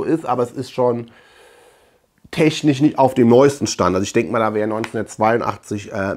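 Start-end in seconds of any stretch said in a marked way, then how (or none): none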